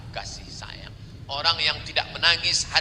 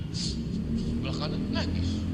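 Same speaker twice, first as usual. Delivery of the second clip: hushed whisper, much lower; 5 dB quieter than usual. Acted. Preacher hushes the crowd describing neighbours weeping, whispered. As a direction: breathe it out unvoiced.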